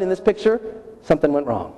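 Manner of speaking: ordinary talk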